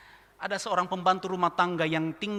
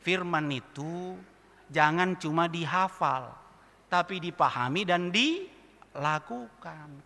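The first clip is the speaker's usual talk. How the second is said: slow emphatic; slower than usual. Real, not acted. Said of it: "sermon point slowed".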